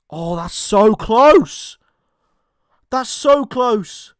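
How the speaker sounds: nervous, slow and strained; tense waiting. Real, not acted. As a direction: hold your breath over the offside call. Waiting on the VAR offside check.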